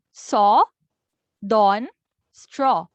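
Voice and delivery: saw, dawn, straw with a round open aw; open o demo. Shown as a demonstration. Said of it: "makes the O sound".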